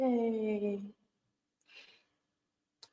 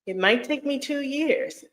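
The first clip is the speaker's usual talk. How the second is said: higher, tentative second pass at the admission; high-pitched repeat; 7 semitones higher than usual. Real, not acted. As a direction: lift the pitch well up, as if still hedging. Repeats it before conceding reality.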